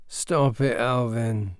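mumbled half asleep; boy mumbles to stepbrother while asleep; sleepy mumble. Acted. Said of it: "he mumbled".

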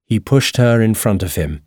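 ordinary delivery, nothing marked

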